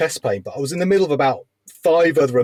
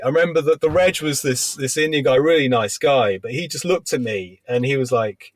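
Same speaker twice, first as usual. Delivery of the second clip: normal talking voice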